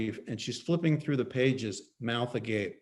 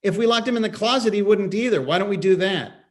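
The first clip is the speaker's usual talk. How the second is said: fast, louder sarcasm; sarcastic snap; 7 semitones higher, 6 dB louder, faster than usual. Acted. Husband mocking his wife's idea, fast and louder. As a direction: throw it at her sarcastically.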